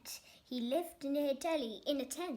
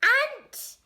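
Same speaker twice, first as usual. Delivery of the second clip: excited; 6 semitones higher, 9 dB louder than usual. A narrator's reading. Hungry creature's excited question.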